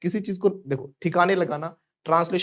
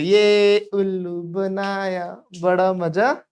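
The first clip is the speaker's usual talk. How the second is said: loud, drawn-out, higher sing-song cheer; gleeful taunt; 5 semitones higher, 5 dB louder, much slower than usual. Acted. Children jumping up after fooling mummy, loud gleeful chant. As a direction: crow it like a child who fooled everyone.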